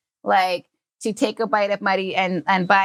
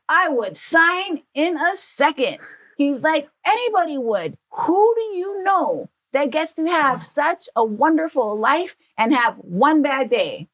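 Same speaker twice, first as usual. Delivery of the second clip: higher, emphatic dad voice; excited dad; 6 semitones higher than usual. Retold or imitated. Dad convincing his daughter, higher and emphatic. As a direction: insist everyone would take it.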